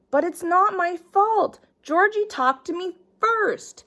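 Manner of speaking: put-on high, pleading child protesting; whiny kid voice. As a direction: whine it like a caught kid. Child whining excuses to teacher.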